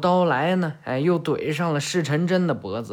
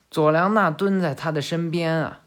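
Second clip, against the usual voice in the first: usual speaking voice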